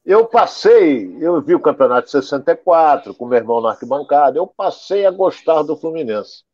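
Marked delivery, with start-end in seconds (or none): none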